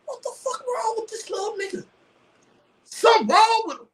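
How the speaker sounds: high mock squeal of disbelief; squeaky disbelief. Still reacting to the clip, high-pitched mocking disbelief. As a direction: pitch it way up, baffled.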